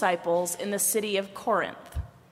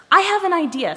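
high, loud burst of inspiration; excited; 9 semitones higher, 8 dB louder, faster than usual. A narrator's reading. Head proposes teaming up with Arms, excited. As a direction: announce it like a sudden brainwave. Head has a bright idea.

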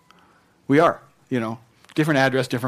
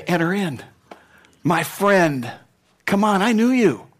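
much higher, warm friendly welcome; welcoming Jesus; 5 semitones higher than usual. Acted. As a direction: greet an old friend at the door.

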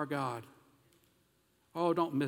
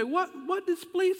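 incredulous question; 4 dB louder, slower than usual. Acted. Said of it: "We say".

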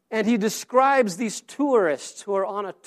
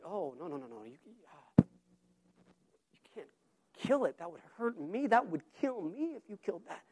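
much softer, near-whispered panic; hushed pleading; 2 semitones lower, 13 dB quieter than usual. Retold or imitated. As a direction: beg in a hushed, breaking voice. Ghost pleads against killing.

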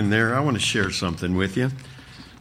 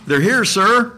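lightly voiced intercom aide, high and loud; 9 semitones higher, 7 dB louder, slower than usual. A narrator's reading. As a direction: announce visitors in a raised intercom call. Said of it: "said a voice from the intercom".

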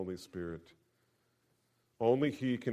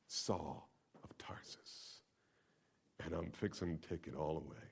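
whisper; 5 semitones lower, 11 dB quieter than usual. Acted. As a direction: breathe the name like a secret. Aside in a hushed voice.

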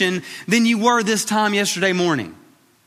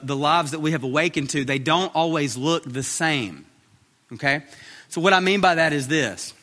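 ordinary delivery

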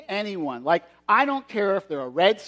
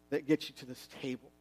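much softer, hushed; solemn hush; 4 semitones lower, 8 dB quieter than usual. Acted. Jesus giving the moral of the story, hushed. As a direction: drop to a reverent hush.

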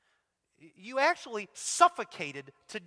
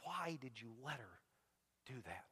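very soft, low, broken into long pauses; hurt daughter's plea; 8 semitones lower, 15 dB quieter than usual. Retold or imitated. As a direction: quote a betrayed little girl, hushed and heavy. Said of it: "I can still hear her voice".

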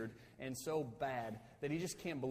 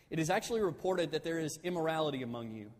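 a slightly raised, formal reading voice; scripture reading; 3 semitones higher, 4 dB louder than usual. Acted. Pastor reading First Corinthians aloud to church, formal reading. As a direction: read the verse out to the congregation.

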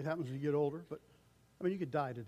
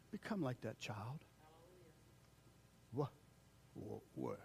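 usual speaking voice

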